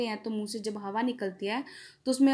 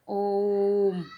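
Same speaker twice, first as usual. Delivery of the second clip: ओम held very long and slow; drawn-out om; 2 semitones lower, 3 dB louder than usual. Shown as a demonstration. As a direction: stretch ओम to three times its length. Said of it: "तिगुना समय लग रहा है ना".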